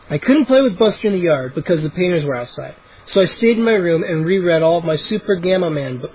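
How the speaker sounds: ordinary delivery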